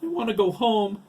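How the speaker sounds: put-on high, loud child's wail; distressed, high-pitched plea; losing-it cry. A narrator's reading. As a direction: cry it out as you lose control. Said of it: "Dougie cried".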